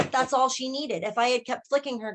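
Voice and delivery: usual speaking voice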